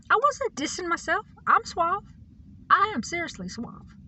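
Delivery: high, squeaky-register insistence; mock protest. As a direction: play-act defending your suaveness, voice way up. Mock protest of being suave.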